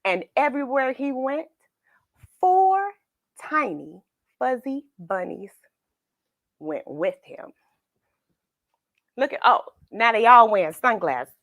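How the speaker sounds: lively picture-book narration; storybook reading. A narrator's reading. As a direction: read it like bedtime story time. Reads picture book aloud.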